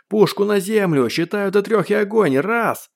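high, urgent commanding shout; yelled order. A narrator's reading. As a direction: yell a countdown warning at full pitch. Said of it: "заорал динамик". (крик с угрозой)

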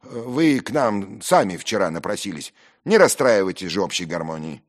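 brusque reproach; rude rebuke. Acted. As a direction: tell off the unwanted guest bluntly. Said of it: "грубо обращаясь ко мне одному".

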